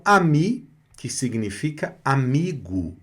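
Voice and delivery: ordinary talk, nothing marked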